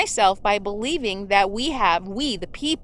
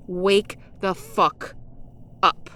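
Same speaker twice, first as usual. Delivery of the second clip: "Wake the fuck up" spaced out slowly with pauses; exasperated slow command; slow emphatic demand; 3 semitones lower, slower than usual. Real, not acted. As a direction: hammer every word. Angry demand to Trump supporters.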